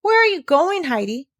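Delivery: put-on boy startled at the sight; exclaiming. A narrator's reading. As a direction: call out in surprise. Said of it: "he exclaimed".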